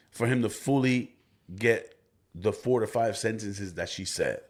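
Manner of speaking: usual speaking voice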